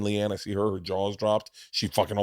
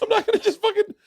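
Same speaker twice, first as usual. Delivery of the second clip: very high, breaking into laughter; high, louder laughing burst; 4 dB louder, faster than usual. Real, not acted. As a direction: squeal it through a laugh. Laughing while telling the story.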